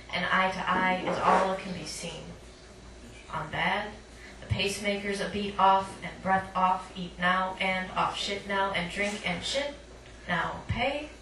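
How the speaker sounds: usual speaking voice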